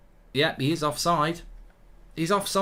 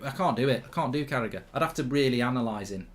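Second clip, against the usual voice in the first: usual speaking voice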